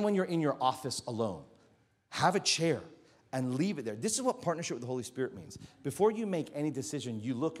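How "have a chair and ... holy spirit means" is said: usual speaking voice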